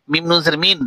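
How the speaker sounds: normal talking voice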